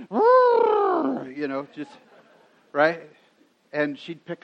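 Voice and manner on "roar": high loud mock roar of a toddler; a child's big playful roar. Daughter showing dad what the elephant says, high loud roar. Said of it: "And she'd go".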